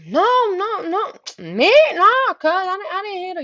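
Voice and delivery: high-pitched, hurried protest; hammed-up scared boyfriend; panicked denial. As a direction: plead innocence in a mock panic.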